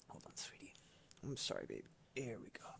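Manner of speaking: soft whisper to the cat. Real, not acted. Streamer whispering to the kitten on her lap. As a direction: hush it gently to the cat.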